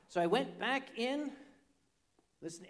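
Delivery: usual speaking voice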